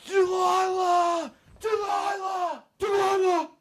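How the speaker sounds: high, slow, anguished shout of the name Delilah; desperate cry. Acted. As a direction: wail the name again and again, drawn out and high. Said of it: "And he went crazy".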